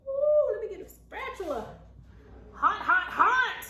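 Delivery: high, loud yelps at the heat; hot hot hot. Real, not acted. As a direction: yelp it out like burnt fingers. Burning fingers on hot biscuits.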